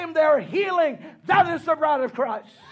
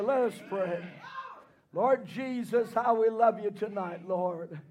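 softer, lower prayer voice; 7 semitones lower, 8 dB quieter than usual. Acted. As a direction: pray quietly and reverently. Prayer after preaching.

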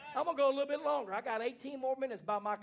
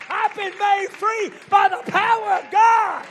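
preaching at full force; 9 semitones higher, 16 dB louder than usual. Acted. Preacher shouting freedom to his congregation, full-force preaching. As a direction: lift the church with a rising shout.

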